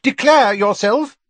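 high, anxious mother demanding an answer; Mrs Weasley. A narrator's reading. Mrs Weasley demands that the late visitor name himself, in a high wary call.

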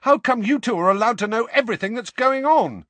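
high, loud, indignant outburst. A narrator's reading. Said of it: "growing louder with every word".

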